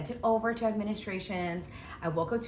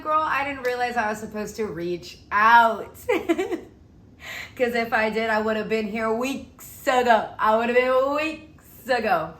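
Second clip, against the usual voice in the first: emphatic; 6 dB louder than usual. Retold or imitated. Laughing at own mix-up.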